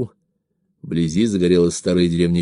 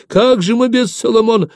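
very high lamenting wail; high-pitched, plaintive old-woman cry; 14 semitones higher, 4 dB louder than usual. A narrator's reading. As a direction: lament like a helpless granny. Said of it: "застонала старуха Самойлиха".

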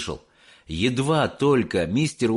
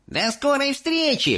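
put-on high, cocky kid's farewell; boy calling out. A narrator's reading. Mike calling goodbye before teleporting. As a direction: shout goodbye triumphantly. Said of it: "прокричал он".

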